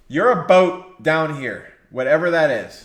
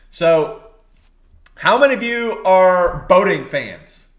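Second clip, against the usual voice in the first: ordinary talk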